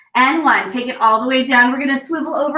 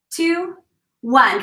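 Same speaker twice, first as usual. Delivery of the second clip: usual speaking voice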